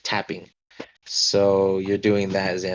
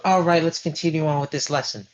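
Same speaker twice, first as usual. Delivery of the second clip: put-on brisk older lady; 8 semitones higher, faster than usual. [name] resumes lesson.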